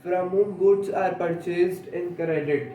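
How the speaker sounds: slow, word-by-word dictation; teacher reading a definition aloud for notes, very slowly. Shown as a demonstration. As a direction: dictate each word slowly so students can write. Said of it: "dictating the definition".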